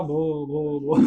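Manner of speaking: stuck repeating "भो"; stammering. Acted. Bholi trying to tell her name, stammering. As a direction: stammer on "भो", never finishing the name. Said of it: "ये हकलाती थी".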